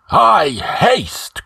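high-pitched eager shout; excited cry. A narrator's reading. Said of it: "cried Rinaldo".

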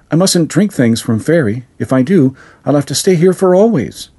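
put-on high small girl's voice, pleading; a child refusing fairy food, scared; girl refusing the fairy brew, frightened; fearful little girl. A narrator's reading. Caroline voiced by narrator.

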